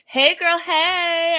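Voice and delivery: bright shout-out to a viewer; excited greeting. Real, not acted. Greeting viewers in live chat.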